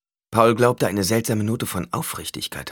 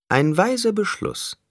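high, slow, smug approval; self-satisfied. A narrator's reading. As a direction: savour each word smugly in a high voice. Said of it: "sagte Skitale selbstzufrieden". (selbstzufrieden, hoch und gedehnt)

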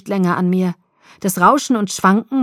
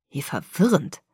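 ordinary delivery, nothing marked

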